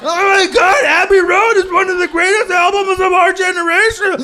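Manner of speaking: high, loud, slow indignant shout. Retold or imitated. Beatles fans yelling at comic, hammed-up outrage. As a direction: scream at him like he insulted your band. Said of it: "They're like".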